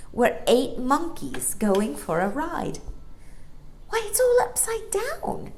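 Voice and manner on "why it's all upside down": put-on much higher, astonished girl's cry; exclaiming girl. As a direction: gasp it out in delight. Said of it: "exclaimed Jane".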